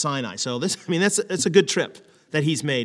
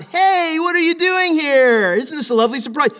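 delighted surprise; 11 semitones higher, 6 dB louder than usual. Shown as a demonstration. Parent NOT rebuking, gushing, high and delighted. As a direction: gush with a falsetto-like happy surprise.

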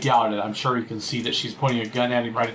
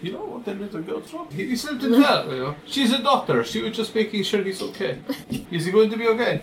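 put-on Russian accent; 8 semitones higher than usual. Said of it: "[name] fakes innocence to guard".

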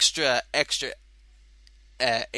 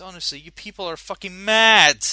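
loud incredulous outburst; exasperated rant; 5 semitones higher, 12 dB louder than usual. Real, not acted. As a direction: rant at the crazy prices. Ranting at eBay sellers.

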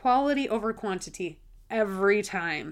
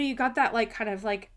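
normal talking voice

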